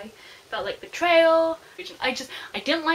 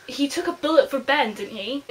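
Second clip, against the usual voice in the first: normal talking voice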